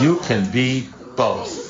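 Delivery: slow, laughing through the words. Real, not acted. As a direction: chuckle out the punchline. Punchline of own story.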